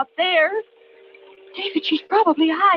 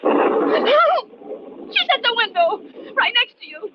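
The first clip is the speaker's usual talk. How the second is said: wife sees the killer at the window, screaming; screaming for help; 9 semitones higher, slower than usual. Acted. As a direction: scream for help, then warn him in terror.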